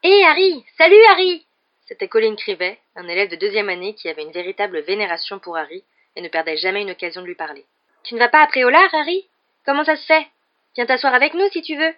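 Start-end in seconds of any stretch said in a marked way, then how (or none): 0.0-1.5 high, loud, eager hail; excited greeting. A narrator's reading. Younger pupil hails his idol across the common room, excited. 8.0-11.9 high, louder, excited questions; eager young fan. A narrator's reading. Younger pupil invites his idol to sit with him, eager.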